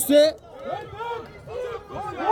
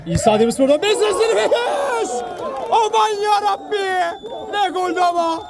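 excited goal call; 6 semitones higher than usual. Real, not acted.

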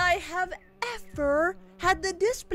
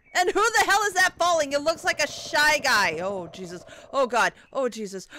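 ordinary talk, nothing marked

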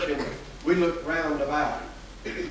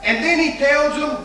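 preaching; 5 semitones higher than usual. Acted. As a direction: preach it out to the congregation. Preacher at full force.

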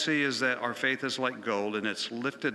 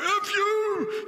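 much higher, louder mock yell; mock shout; 4 dB louder than usual. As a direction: bark it out like the coach. Coach curses after the prayer.

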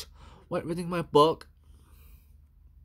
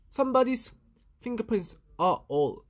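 ordinary delivery, nothing marked

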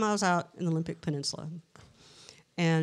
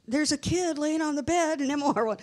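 higher-pitched retold insistence; insistent protest; 10 semitones higher than usual. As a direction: insist to doubting colleagues, pitch raised. Retold insistence to disbelieving nurses.

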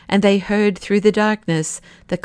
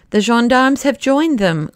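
higher-pitched exclamation; dismayed cry; 6 semitones higher, 4 dB louder than usual. A narrator's reading. Professor sees gendarmes join the mob, crying out. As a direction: cry out, pitch raised throughout. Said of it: "cried the professor".

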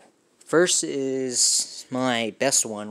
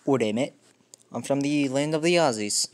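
loud, hammy Aussie accent; amateur Australian accent, punched out; 3 semitones higher, 5 dB louder than usual. Shown as a demonstration. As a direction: announce yourself in a broad mock Australian accent. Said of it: "the next one I'll just do is Australian".

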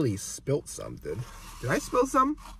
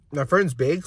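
ordinary talk, nothing marked

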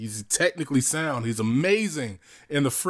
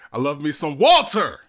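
WALTER boomed out loud at the end; proud, loud punch on WALTER; corrected all-caps WALTER; 2 semitones higher, 7 dB louder than usual. Shown as a demonstration. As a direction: build to a bellowed WALTER. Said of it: "let me get my voice right".

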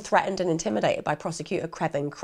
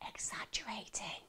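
whisper; 2 semitones higher, 21 dB quieter than usual. Shown as a demonstration. Narrator stressing to listeners, "exaggerating" whispered. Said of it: "I am not exaggerating".

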